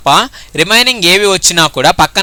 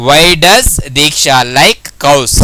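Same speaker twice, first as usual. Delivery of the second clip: slow dictation; 3 semitones lower, much slower than usual. Shown as a demonstration. Teacher dictating answer slowly.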